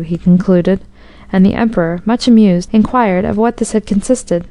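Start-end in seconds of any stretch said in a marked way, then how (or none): none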